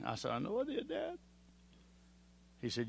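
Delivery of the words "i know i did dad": a son's broken admission, barely holding together; choked confession. Retold or imitated. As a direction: voice climbs high and thin, close to tears. Son confessing to his father.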